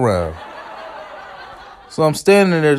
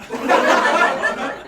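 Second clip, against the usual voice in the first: high-pitched breathy giggle; high laugh; 14 semitones higher, 4 dB quieter than usual. Real, not acted. Laughing at the insult.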